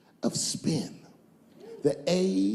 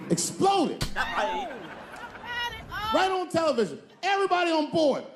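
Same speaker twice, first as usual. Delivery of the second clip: dramatic shout; 11 semitones higher than usual. Real, not acted. Dramatic space shuttle story.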